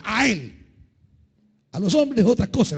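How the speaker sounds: ordinary delivery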